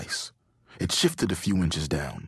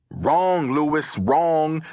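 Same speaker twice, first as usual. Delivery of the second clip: high, drawn-out contradiction; emphatic correction; 7 semitones higher, 4 dB louder, much slower than usual. A narrator's reading. Brandon corrects his friend's riddle answer, emphatic correction. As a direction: stretch each "wrong", pitched up.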